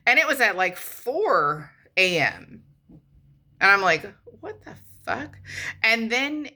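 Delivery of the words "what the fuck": a sharp, breathy hush of disbelief. Retold or imitated. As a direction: hiss it under your breath, baffled. Woken by 4am text, hushed disbelief.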